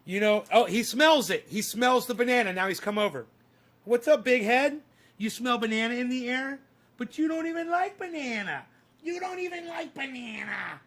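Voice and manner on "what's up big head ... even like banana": high teasing voice for the dog; dog talk. Real, not acted. Owner teasing his dog about banana, high teasing voice. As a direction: tease the dog in a high sing-song.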